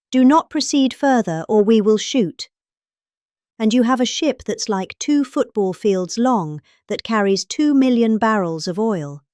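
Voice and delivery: ordinary delivery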